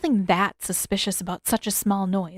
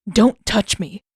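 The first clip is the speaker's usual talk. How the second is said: snapping; 6 dB louder, slower than usual. A narrator's reading. Zuko smacks hand away.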